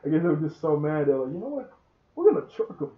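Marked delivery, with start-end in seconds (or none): 1.3-2.9 high-pitched playful declaration; comic retold resolve. Mad wasp deciding to truck Odd, played for laughs. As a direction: announce the charge in a silly high voice. Acts out angry enemy deciding to charge.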